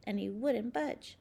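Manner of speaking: plain reading voice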